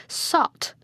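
sudden high-pitched angry exclamation; acted burst of temper, pitch jumping; cried in rage. A narrator's reading. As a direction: cut in with an irate insult. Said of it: "cried Manfred in a rage".

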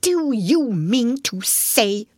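slow, high-pitched incredulity; excited. A narrator's reading. Rat challenging mole about the doormat, excited. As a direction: stretch it out in excited disbelief. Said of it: "cried the excited rat".

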